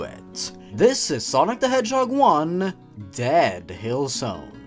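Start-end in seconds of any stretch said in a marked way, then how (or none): none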